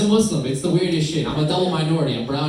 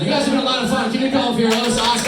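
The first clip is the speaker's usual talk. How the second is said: much louder and higher; loud sign-off; 12 semitones higher, 7 dB louder than usual. Real, not acted. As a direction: belt the farewell to the crowd. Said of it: "wrapping up the set".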